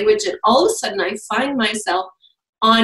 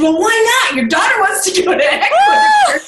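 husband surprised; 12 semitones higher than usual. Retold or imitated. Husband pushing wife to book Ecuador trip, mock-indignant. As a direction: play the husband suddenly all for it. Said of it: "He said".

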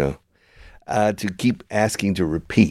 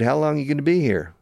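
high Texan imitation, run together; Texas accent; 8 semitones higher than usual. Texan repeats the question to a confused learner, Texas accent. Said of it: "people from Texas talking like this".

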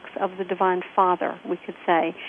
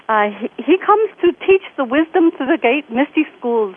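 lilting imitation Indian accent, pitched up; 5 semitones higher, 5 dB louder than usual. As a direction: imitate an Indian friend explaining in his accent. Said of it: "Great Indian accent".

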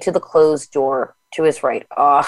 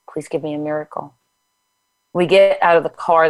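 ordinary delivery

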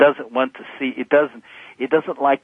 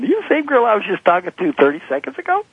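incredulous husband; 8 semitones higher than usual. Retold or imitated. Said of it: "I'm looking at her going".

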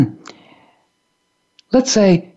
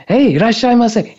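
enthusiastic welcome; 6 semitones higher than usual. Retold or imitated.

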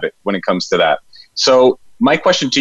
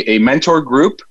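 usual speaking voice

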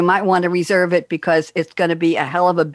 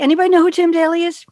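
high, fast quizzing question; eager quiz; 10 semitones higher, faster than usual. Real, not acted. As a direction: tease them with the question.

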